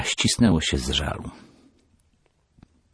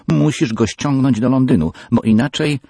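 much higher, loud pleading ultimatum; demanding threat; 9 semitones higher, 6 dB louder than usual. Acted. Peggy presses her father with a loud threat. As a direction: push hard, half threat half plea. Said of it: "zażądała".

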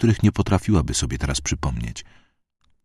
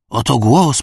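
higher, louder proclamation; the priest repeats it higher and louder to the crowd; raised repeat; 7 semitones higher, 4 dB louder, slower than usual. A narrator's reading. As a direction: lift it up triumphantly. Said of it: "powtórzył".

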